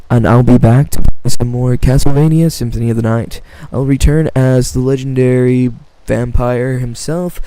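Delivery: loud, declaiming outro voice; loud theatrical announcer delivery; dramatic sign-off. Acted. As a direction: announce it like a grand narrator.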